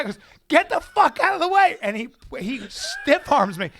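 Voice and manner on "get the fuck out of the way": high, loud furious order; aggressive shout. Retold or imitated. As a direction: yell as if shoving someone aside. Retold guy shoving him aside.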